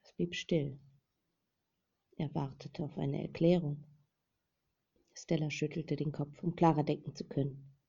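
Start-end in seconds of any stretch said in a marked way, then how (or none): none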